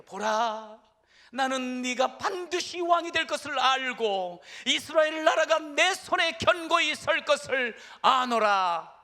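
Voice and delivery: high, loud, slow declaration; loud proclamation. Acted. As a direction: proclaim it for three thousand soldiers to hear. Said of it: "다 듣도록 외치는 거예요".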